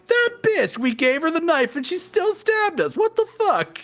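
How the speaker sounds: outraged cartoon squeal at being stabbed; The Cheated. The betrayed inner voice ranting about the princess, squeaky and outraged. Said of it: "the first thing he said is like".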